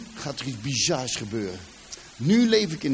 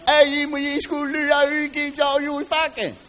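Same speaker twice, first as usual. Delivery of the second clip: loud, much higher Brabant dialect call; mock Brabant dialect, loud and high; Brabant dialect kid; 10 semitones higher, 5 dB louder than usual. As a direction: shout it like a Brabant village kid. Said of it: "ik verstond die kinderen niet".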